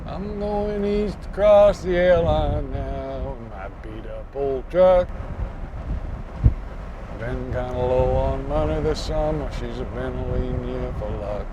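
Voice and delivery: poem reading. Acted. Reads rhymed verse slowly.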